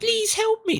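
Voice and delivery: high falsetto plea; female voice. Pretend. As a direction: squeak it like a damsel. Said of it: "I definitely can't do female voices".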